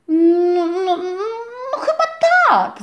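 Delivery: high, loud excited chick squeal; happy squeak. A narrator's reading. Chick answering the rabbit, high joyful squeaks. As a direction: squeal yes with delight. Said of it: "zapiszczał radośnie kurczak".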